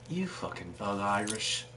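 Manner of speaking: lightly put-on Irish accent, slow and low; a mock Irish jab at the player, drawn out slowly. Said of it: "my British accent's turning into Irish".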